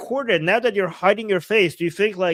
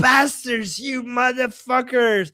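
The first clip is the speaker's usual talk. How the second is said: swearing shout; 7 semitones higher, slower than usual. Retold or imitated. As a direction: yell the insults like the angry man, a bit drawn out. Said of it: "he was, like, swearing".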